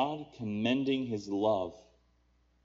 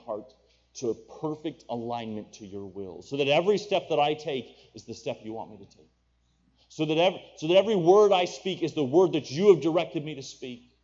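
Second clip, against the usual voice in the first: raised, louder preaching plea; impassioned prayer; 5 semitones higher, 7 dB louder than usual. Acted. Preacher voicing the congregation's cry of the heart, impassioned prayer. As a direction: lift the voice high in fervent petition.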